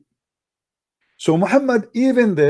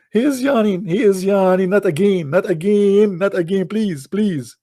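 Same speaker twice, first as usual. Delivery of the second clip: higher, mock-panicked begging; hammed-up desperate wail; pleading God; 4 semitones higher than usual. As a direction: plead in comic alarm, repeating yourself. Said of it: "Allah saying".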